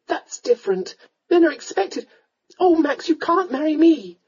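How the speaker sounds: high, distressed heroine; protesting young lady. Acted. Young lady protests she can't marry him, upset. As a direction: argue high and urgently.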